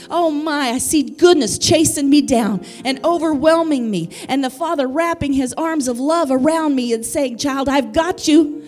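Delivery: driving, emphatic sermon delivery; impassioned preaching. Real, not acted. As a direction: build the promise with fervour for the congregation. Preaches, voicing the Father's promise.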